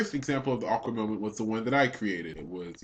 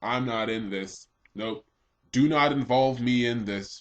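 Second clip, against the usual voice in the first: slow insistent refusal; slower than usual. Retold or imitated. Refusing to be involved in fight.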